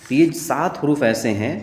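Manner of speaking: normal talking voice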